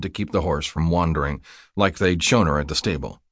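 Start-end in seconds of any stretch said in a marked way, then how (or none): none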